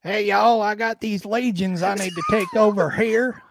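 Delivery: hammed-up Southern drawl. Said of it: "he's like".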